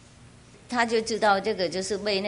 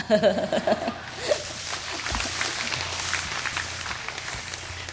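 long airy laugh, rising; breathy amused laughter; real laugh; 3 dB quieter than usual. Real, not acted. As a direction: laugh it out at length.

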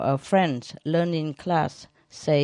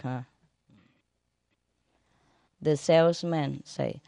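ordinary talk, nothing marked

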